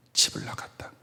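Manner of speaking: breathy whispered confession; whispered grieving father. Retold or imitated. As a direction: whisper it like a secret that hurts.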